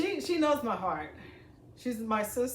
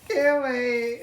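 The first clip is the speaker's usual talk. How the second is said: high giddy laugh, then loud; squealing laugh; 11 semitones higher, 9 dB louder, slower than usual. Real, not acted. Vlogger squealing about pasta tools, giddy laugh. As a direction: laugh out in delight. Excited over pasta tool.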